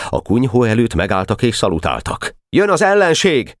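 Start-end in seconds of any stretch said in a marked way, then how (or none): 2.5-3.6 high battle cry rising to the order; urgent, high-pitched commander's shout; shouted alarm. A narrator's reading. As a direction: sound the alarm in a very high call. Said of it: "kiáltotta Boka".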